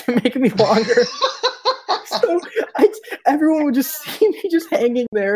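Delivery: high, laughing through the words; helpless real laughter breaking into the sentence. Real, not acted. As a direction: crack up while telling your own story. Laughing at own story.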